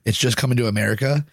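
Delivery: usual speaking voice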